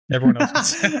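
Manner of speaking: can't hold the laugh on the punchline; laughing through it. Acted. Server telling Tom only he must leave, laughing through the line.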